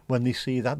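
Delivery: ordinary talk, nothing marked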